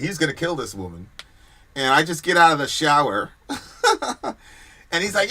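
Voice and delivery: ordinary talk, nothing marked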